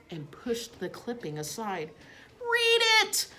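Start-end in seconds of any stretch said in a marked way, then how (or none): 2.4-3.3 high, loud squeak; a tiny hamster squeaking a demand; squeaked command. A narrator's reading. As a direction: squeal it out urgently. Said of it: "I squeaked right out".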